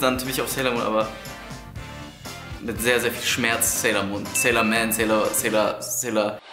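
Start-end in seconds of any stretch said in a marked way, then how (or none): none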